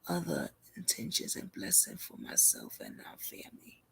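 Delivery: hushed whisper reading a chat message; reading her words softly. Retold or imitated. Streamer reading a viewer's message in a hushed whisper. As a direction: read the message under your breath.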